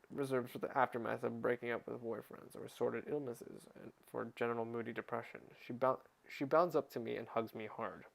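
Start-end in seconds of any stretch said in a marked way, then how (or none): none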